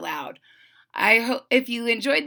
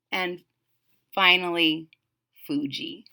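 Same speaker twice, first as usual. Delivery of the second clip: ordinary delivery, nothing marked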